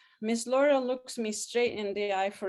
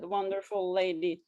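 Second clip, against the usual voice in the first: usual speaking voice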